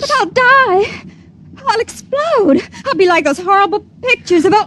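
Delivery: pleading through tears as panic rises; tearful plea. Acted. Girl told she must die.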